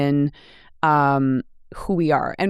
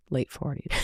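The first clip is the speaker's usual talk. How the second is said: laughing. Real, not acted. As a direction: repeat it under your breath, then crack up. Repeats own age, breaking into laughter.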